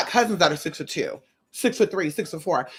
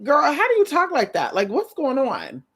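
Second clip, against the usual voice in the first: incredulous; 7 semitones higher than usual. Retold or imitated. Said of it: "But I'm like".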